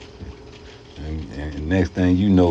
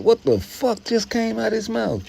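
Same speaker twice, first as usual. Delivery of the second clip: baffled. Retold or imitated.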